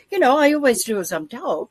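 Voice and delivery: ordinary talk, nothing marked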